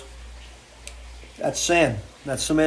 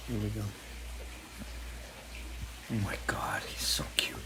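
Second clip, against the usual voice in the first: soft whisper over a cute dog; hushed whisper; 5 semitones lower, 14 dB quieter than usual. Real, not acted. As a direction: breathe it so the dog stays still.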